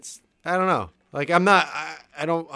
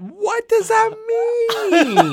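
laughing; much slower than usual. Real, not acted. Laughing disbelief at the quote.

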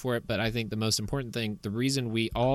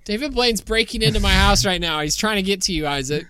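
excited; 8 semitones higher, 6 dB louder than usual. Pretend. Playful alarm about intruder.

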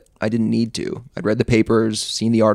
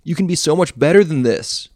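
loud, higher righteous call; preachy call; 6 semitones higher, 4 dB louder than usual. A narrator's reading. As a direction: call after him, self-righteous. Hero calling after fleeing man.

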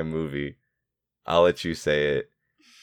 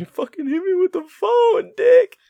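very high, louder squeal; squealing Stu; 7 dB louder than usual. Retold or imitated. Friend quoting Stu's phone line again, high squeal. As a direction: squeal it like a child. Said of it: "best part".

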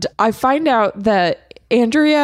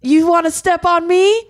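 defiant taunt; 7 semitones higher than usual. Retold or imitated. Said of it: "she's like".

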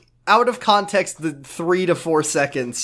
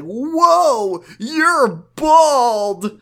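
high, loud cartoonish gasp of shock; mock shocked Josh; 9 semitones higher, 4 dB louder, much slower than usual. Josh gawking at the girl's buzzed hair, high mock shock. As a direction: blurt it out like a cartoon kid. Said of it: "Josh is like".

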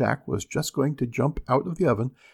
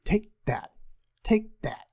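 angry mother; 8 semitones higher than usual. Acted. Mother angrily beating Jack.

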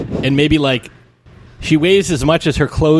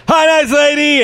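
high, louder call across a room; son-in-law calling out cheerily to his mother-in-law; yelled greeting; 13 semitones higher, 4 dB louder than usual. Retold or imitated. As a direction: yell it across the room to her. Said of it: "and yell across the room".

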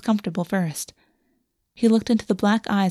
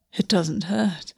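wonderingly; slower than usual. Acted.

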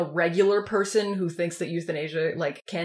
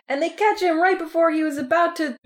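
normal talking voice

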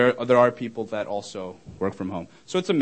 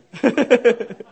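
high, loud burst of giggles; embarrassed laugh; 12 semitones higher, 6 dB louder than usual. Real, not acted. Speaker laughing after getting a little excited, high giggling laugh. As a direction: laugh at your own slip, pitched right up.

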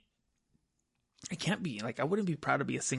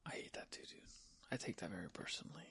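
hushed, whispered aside; 7 semitones lower, 20 dB quieter than usual. Real, not acted. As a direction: whisper it under your breath.

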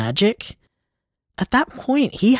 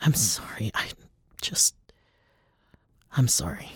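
much softer, fading whispered sorry; broken apology; 6 semitones lower, 9 dB quieter than usual. Real, not acted. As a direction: let the apology crumble into a whisper. Apologising while falling apart.

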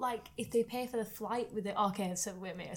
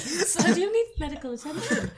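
laughing, a bit higher and louder; 7 semitones higher, 5 dB louder than usual. Real, not acted. Friends trading jokes while laughing, higher and louder. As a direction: joke it out mid-laugh.

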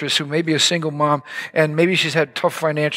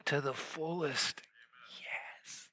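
slow, barely audible whisper; awed whisper; 4 semitones lower, 20 dB quieter, much slower than usual. Acted. Preacher ending the believer's run of yeses, hushed slow whisper. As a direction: drop to a tiny hushed breath.

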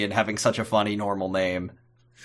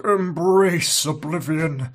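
slow, higher put-on supervillain voice; Steppenwolf; 4 semitones higher, slower than usual. Alien villain proclaiming doom, slow and hammed-up. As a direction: intone it slowly like a comic-book villain. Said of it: "He's like Steppenwolf".

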